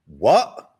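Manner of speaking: loud, rising double-take; mock disbelief. The poor questioning the promise of heaven, loud mock disbelief. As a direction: blurt it out as if baffled.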